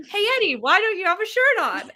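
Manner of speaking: high, loud call-out question; imitated passersby. Strangers on the phone asking Eddie, high mock call-out. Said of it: "they're like".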